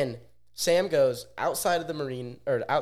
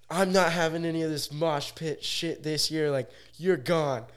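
lightly voiced fed-up cop, higher pitch; imitated angry cop; 6 semitones higher than usual. Cop ejecting him from the mosh pit, imitated stern telling-off. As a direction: lay down the law, mildly acted.

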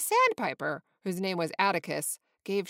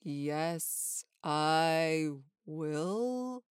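low, drawn-out answer from a rock; slow, spooky reply in a lower voice; mysterious voice; 3 semitones lower, much slower than usual. A narrator's reading. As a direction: stretch it out like a talking rock. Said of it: "came a voice from".

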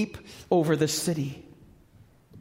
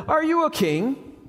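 mocking Pilate; 10 semitones higher than usual.

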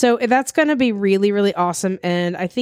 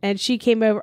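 normal talking voice

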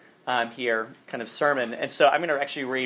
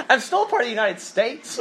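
mock exasperated; 7 semitones higher than usual. Southern kid answering northern questions, laughing exasperation. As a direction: act out his baffled retort. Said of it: "those people for years would ask me".

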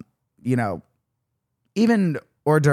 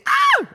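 high startled shriek; 4 dB louder than usual. Real, not acted. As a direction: shriek in sudden alarm. Realizes wrong age.

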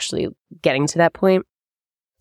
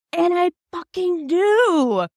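stunned slow emphasis; slow, high incredulous; 12 semitones higher, much slower than usual. Real, not acted. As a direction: drag it out, pitch up in disbelief. Finding the copy.

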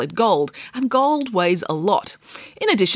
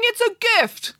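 king. Acted. The King speaks.

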